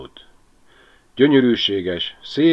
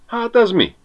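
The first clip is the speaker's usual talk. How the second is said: high-pitched, louder cry of surprise; exclaiming; 14 semitones higher, 5 dB louder than usual. A narrator's reading. Berti pulls a bottle from the seat, exclaiming. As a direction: cry out at a sudden find. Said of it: "kiáltott".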